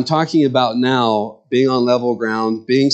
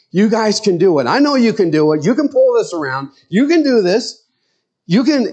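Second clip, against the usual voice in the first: high, eager coaching cheer; grandfather cheering the TV; 9 semitones higher than usual. Retold or imitated. Grandfather urging his football team on through the television, eager high cheer. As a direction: pump up a losing team from the couch. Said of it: "talk to the television in such a way to encourage".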